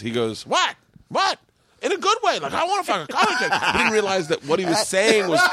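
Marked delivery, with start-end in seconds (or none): 0.5-3.5 high-pitched, incredulous self-defense; mock indignant protest. Boston comic defends himself to a shocked crowd, mock indignant. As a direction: protest innocence in a much higher voice.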